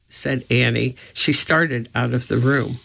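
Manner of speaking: ordinary delivery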